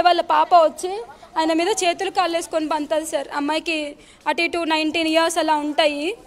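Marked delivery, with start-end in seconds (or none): none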